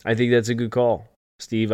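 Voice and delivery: normal talking voice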